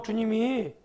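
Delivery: ordinary talk, nothing marked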